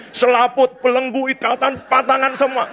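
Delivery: high-pitched rattling off of deliverance words; mock prayer command. Lists prayer commands in mock urgency. (menirukan doa pelepasan dengan nada tinggi)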